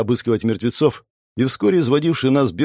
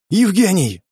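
raised, higher-pitched call; 8 semitones higher, 4 dB louder, slower than usual. A narrator's reading. Alex tries to rouse Evgeny, calling out. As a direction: call his name to rouse him. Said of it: "заорал в ухо, что было силы".

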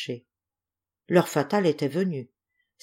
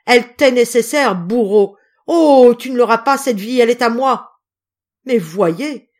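loud, high-pitched impassioned denunciation; desperate, raging indignation; furious outrage; 5 semitones higher, 8 dB louder than usual. A narrator's reading. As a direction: defend your child with full fury. Mother rages at husband threatening her child.

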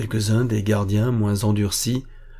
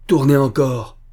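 loud, raised, enraged command; cri de rage; 4 semitones higher, 6 dB louder than usual. A narrator's reading. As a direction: shout it in a rage. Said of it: "cria Mathéus, blême de rage". (ordre crié avec rage)

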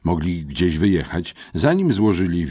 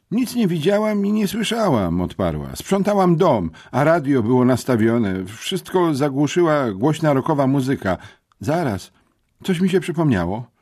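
put-on higher, louder voice of a village girl; girl's voice; 11 semitones higher, 6 dB louder than usual. A narrator's reading. Marta telling the boys what she heard, high and eager.

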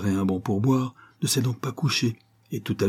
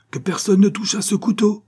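high, raised urgent command; commanding order; 11 semitones higher, 5 dB louder than usual. A narrator's reading. Captain forbidding anyone to touch the knife, commanding order. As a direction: order everyone to stop at once. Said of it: "ordonna-t-il".

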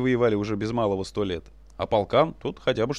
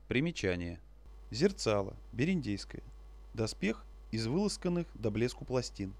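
footnote aside; 2 semitones lower, 11 dB quieter than usual. A narrator's reading. Footnote read aside, softer.

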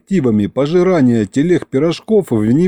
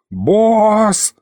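higher, mild mock yell of the imp; 8 semitones higher than usual. Aristofan yelling into the narrator's ear. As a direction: shout into the boss's ear. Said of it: "заорал мне прямо в ухо".

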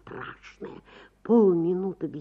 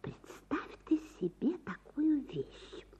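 slow secretive whisper; wife whispering a secret to her husband; 9 semitones higher, 6 dB quieter than usual. A narrator's reading. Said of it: "и зашептала".

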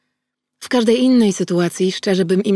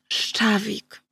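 reluctant; 3 semitones higher, much slower than usual. Acted. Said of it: "odpowiedziała niechętnie".

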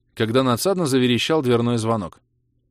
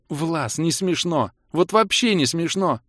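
high, slow, drawn-out female voice; offended rebuke; 8 semitones higher, slower than usual. Acted. Kira objecting to a scary joke, offended. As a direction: stretch out an upset objection.

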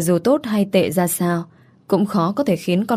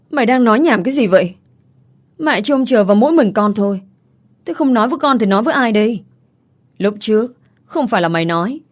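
higher-pitched, indignant scolding; exasperated mother; 3 semitones higher than usual. Acted. Mother confronting her son over the chairman's seat, exasperated scolding. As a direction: press him with indignant questions.

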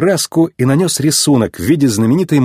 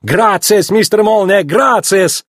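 high, excited Italian shopkeeper; exuberant, high-pitched thanks; effusive Italian thanks; 8 semitones higher, slower than usual. A narrator's reading. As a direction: gush gratitude in a raised voice. Luigi thanks his customer effusively.

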